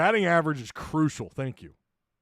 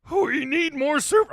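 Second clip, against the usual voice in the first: high comic cartoon voice; squeaky cartoon voice for the super chat; put-on voice; 11 semitones higher, 5 dB louder than usual. Host reading a super chat in a silly voice. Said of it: "I gotta do the voice".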